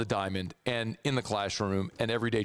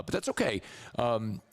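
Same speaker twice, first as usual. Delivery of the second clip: ordinary delivery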